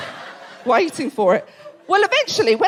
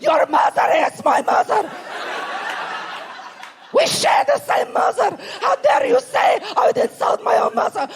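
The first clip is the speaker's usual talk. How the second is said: hammed-up offended dad; dad's mock outrage; 10 semitones higher than usual. Comic dad shouting back at the uncle, hammed-up outrage. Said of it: "My dad stands up and goes".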